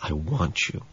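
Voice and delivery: soft murmured line. A narrator's reading. Narrator voicing a movie heroine's murmur, soft and low. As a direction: breathe it like a film kiss. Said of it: "the subsequent murmur of".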